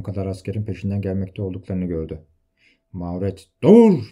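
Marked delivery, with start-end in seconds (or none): 3.6-4.1 loud, high cry of command; shouted order. A narrator's reading. Mauret halting the fleeing Pardayan, shouted order. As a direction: yell it to halt someone. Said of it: "diye haykırdı".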